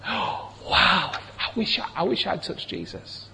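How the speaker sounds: breathy hushed wish, barely voiced; awed whisper. Listener hearing the healed woman's story, awed whisper. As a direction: whisper it as if wishing you'd been there. Imagined listener longing in awe.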